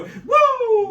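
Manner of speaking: Flair woo. Retold or imitated. Family imitates Ric Flair.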